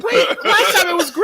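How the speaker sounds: very high, silly squeak; a high cartoonish imitation of a friend; squeaky mock voice. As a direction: pitch it way up like a cartoon. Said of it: "Moog's like".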